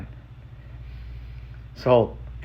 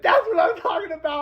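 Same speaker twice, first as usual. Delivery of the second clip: much higher, shrieking cheer; crying out in joy. Real, not acted. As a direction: cheer like the story just hit home. Thrilled by grandpa story.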